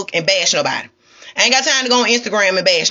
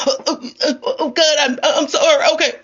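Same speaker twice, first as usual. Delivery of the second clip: mock stammering apology; 6 semitones higher, faster than usual. Mock fake apology to God.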